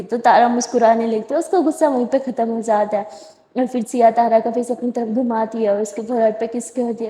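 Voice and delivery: ordinary delivery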